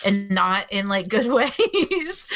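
laughing through the words, slowing down; nervous laughter. Real, not acted. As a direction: chuckle nervously while talking. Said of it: "maybe I'm laughing 'cause I'm nervous".

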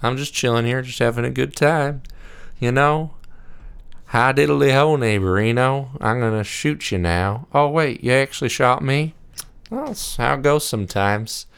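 breezy and carefree, like nothing matters; Woody Harrelson impression. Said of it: "It's just like".

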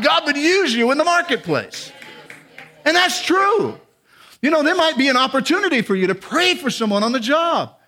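high-pitched, driving exhortation; impassioned preaching. Real, not acted. Preacher urging the congregation to serve God at work, impassioned. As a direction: lift the voice and urge them on.

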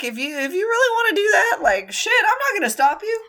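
high, fast, offhand mock resignation; resigned shrug voice. Friend voicing the zombie shrugging off the threat, high and fast. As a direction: toss it off like you don't care. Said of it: "And Wen Ning's like".

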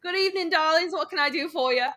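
high, put-on bartender greeting; female bartender. Female bartender welcoming newcomers to the pub, high put-on greeting. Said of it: "There's a female bartender there".

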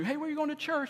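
high-pitched imagined asker; mock friendly question. Someone asking where you go to church, high-pitched mock question.

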